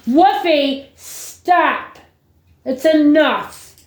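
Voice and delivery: high, sharp call to stop the dog; exasperated owner calling off her dog; yelling at the dog. Real, not acted. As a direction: snap the dog out of it, then drag out the last words slowly.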